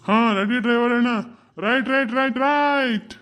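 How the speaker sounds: loud high call of right right; conductor's call. Acted. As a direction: shout to the driver to go. Conductor calls out to driver.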